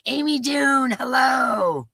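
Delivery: high, loud shouted hello; excited greeting. Real, not acted. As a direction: call out a happy hello. Greeting a chat member.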